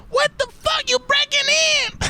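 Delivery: extremely high squealed disbelief; hammed-up, sky-high panicked squawk; imitating an outraged man. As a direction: shriek it in mock outrage. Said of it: "And he's like".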